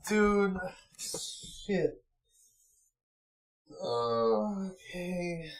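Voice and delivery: quiet dejected mumbling at the long stream ahead; muttered to himself. Real, not acted. Streamer dejected, muttering near mic.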